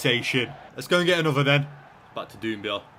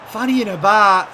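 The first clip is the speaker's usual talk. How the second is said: mock outrage; 7 semitones higher, 8 dB louder than usual. Real, not acted. Keeper dithering on ball.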